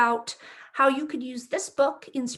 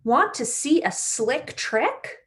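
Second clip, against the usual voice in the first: bright, lively storybook read-aloud; reading to children; slower than usual. A narrator's reading. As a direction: read it like story time for little kids. Reads picture book to children.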